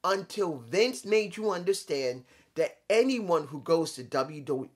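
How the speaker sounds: normal talking voice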